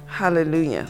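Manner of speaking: ordinary talk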